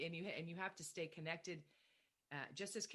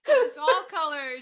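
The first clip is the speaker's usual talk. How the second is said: laughing out loud; 10 semitones higher, 23 dB louder than usual. Real, not acted. Guest bursts out laughing at joke.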